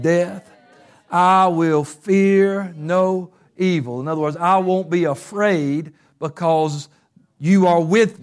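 slow, weighty reading of the psalm; solemn scripture recitation. Acted. Preacher reciting Psalm twenty-three to church, solemn. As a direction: let each phrase land slowly.

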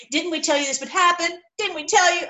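whiny told-you-so; grumbling people. Retold or imitated. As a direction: nag like the complaining crowd.

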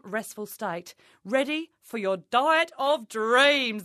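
ordinary talk

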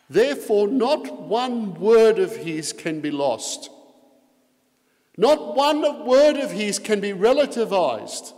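loud emphatic sermon; forceful preaching. Real, not acted. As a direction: preach it hard from the pulpit.

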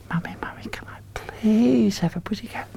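whispered prompt. Acted. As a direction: whisper the line for the kids to repeat. Prompting children as Hannah.

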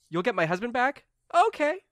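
high, hopeful voice leaping up; tearful charmed wife. Acted. Charmed wife hoping for husband back, high and hopeful. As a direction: pipe up with sudden, charmed hope. Charmed attacker, tears, smile appears.

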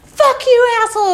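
high, loud re-enacted fury; screamed insult. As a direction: hurl it back as a yell. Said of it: "I'm screaming on your podcast".

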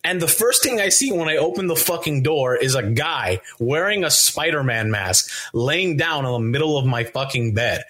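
ordinary talk

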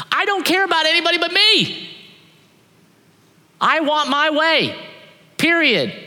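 put-on stomp your foot like a spoiled kid; mock spoiled Jonah insisting on his way; selfish Jonah.

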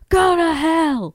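loud, high, drawn-out acted yell. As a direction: yell it slowly, like a betrayed villain. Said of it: "he yells".

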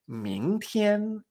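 "mingtian" rising, then level high; drawn-out model "mingtian", up and sustained; tones two-one. Shown as a demonstration. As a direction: climb on "ming" and hold "tian". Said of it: "para la combinación".

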